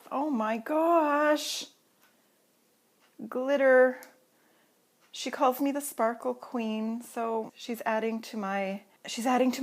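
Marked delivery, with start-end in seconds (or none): 0.1-4.0 slow, drawn-out "oh my gosh", higher; awed gasp. Real, not acted. Vlogger finding glitter in the gift package, slow and awed. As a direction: let it stretch out in delighted amazement.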